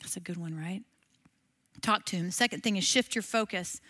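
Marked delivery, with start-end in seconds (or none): 0.0-0.8 whispered aside; a soft whispered aside to the class. Real, not acted. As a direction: breathe it under your voice. Aside after the prayer.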